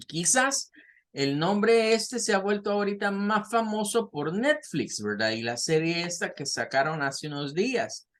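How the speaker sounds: normal talking voice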